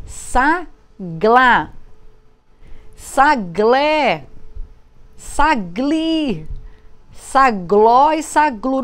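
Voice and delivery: saglá, saglé, sagli, sagló, saglu said slowly, tongue rolling; tongue-twisting syllables. Shown as a demonstration. Teacher blending sa with gl syllables for pupils, slow. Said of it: "enrolou a língua".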